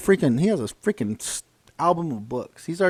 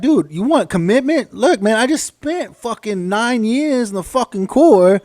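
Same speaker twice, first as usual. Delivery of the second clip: louder, higher, slow and emphatic; indignant rant; 8 semitones higher, 5 dB louder, slower than usual. Retold or imitated. Marine telling civilians about commitment, slow loud rant. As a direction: spell out the commitment, slowly and hard. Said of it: "We're like".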